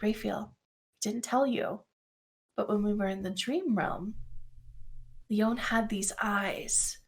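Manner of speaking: soft, slow, near-whispered recollection; hushed confiding. Acted. Gris tells Raphael of the dream realm, hushed and slow. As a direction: confide it gently with long pauses.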